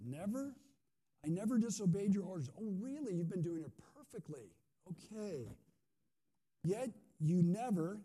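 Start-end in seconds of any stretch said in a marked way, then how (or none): 0.0-2.5 "never" leaned on, high and louder; stress on never. Acted. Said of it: "There's the absolute". 6.6-8.0 slow, loud "never" as an absolute; stress on never. Acted. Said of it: "here we go again".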